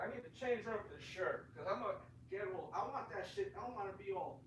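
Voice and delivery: far from the mic, muffled and distant. Real, not acted. Streamer changing shirt away from the mic, distant voice. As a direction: talk from across the room.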